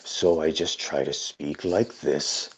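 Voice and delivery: sanding down a creepy voice to sound kind; forced nice voice. Acted. Ghoul trying not to frighten the party, soft and slow. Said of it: "the nicest I can make my voice sound".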